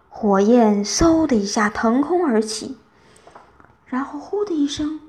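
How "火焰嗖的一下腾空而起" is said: usual speaking voice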